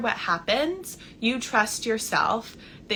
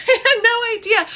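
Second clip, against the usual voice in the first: laughing high and loud through the words; giggling at her own past; 14 semitones higher, 8 dB louder than usual. Real, not acted. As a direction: break into a real laugh.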